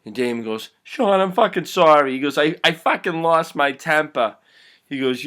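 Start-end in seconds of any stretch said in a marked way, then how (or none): 0.8-2.1 high, loud, upset apology; agitated apology. Acted. Damon apologizing to bleeding Sean, agitated and loud. 2.4-4.4 raised, upset confession; agitated apology. Acted. Damon excusing himself to Sean, agitated.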